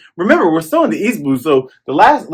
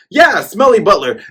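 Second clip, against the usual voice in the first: ordinary talk, nothing marked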